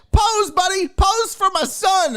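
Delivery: put-on high and loud, eager old man voice; creepy dad voice. Dad asking the kid for a photo, high loud eager voice. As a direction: call out like an overeager dad. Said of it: "he's like".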